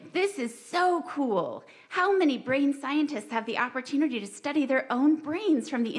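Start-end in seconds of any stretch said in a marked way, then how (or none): 0.1-1.6 awed. Retold or imitated. Inner voice marveling at stroke.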